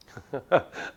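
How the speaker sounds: short laugh cut off by pain; weak pained laugh. Acted. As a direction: laugh then wince. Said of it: "I guess I can't laugh either".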